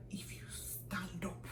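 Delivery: slow, whispery mock menace; hushed threat. Senior threatening kneeling junior, hushed and menacing. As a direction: hiss it slowly as a warning. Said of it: "this girl threatens my life".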